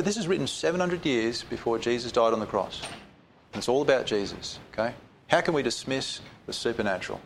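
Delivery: usual speaking voice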